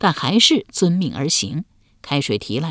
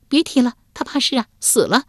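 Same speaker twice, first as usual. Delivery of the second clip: put-on young woman voice; 7 semitones higher than usual. A narrator's reading. Wife's voice.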